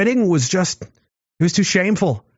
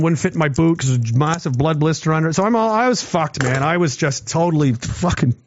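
ordinary talk

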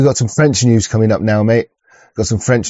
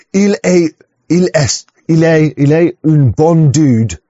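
slow, lightly put-on French accent; half-hearted French accent; mock French; 4 semitones higher, slower than usual. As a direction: ham up the French a bit, slowly. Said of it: "how do you say it in French?".

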